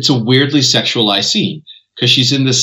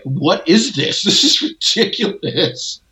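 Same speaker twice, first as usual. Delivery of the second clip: amused disbelief; 6 semitones higher, slower than usual. Retold or imitated. Acting out own baffled, amused reaction.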